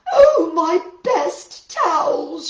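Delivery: loud, high, slow wail; dismayed cry. Acted. Missus Rogers finding her best towels, loud wail. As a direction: moan over the ruined towels.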